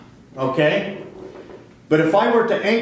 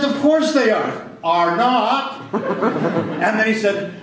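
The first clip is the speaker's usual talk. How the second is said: a higher-pitched, indignant teacher, slower on "went ballistic"; angry teacher; 5 semitones higher, slower than usual. Retold or imitated. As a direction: mimic the complaining teacher, pitched up. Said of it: "And he's mad".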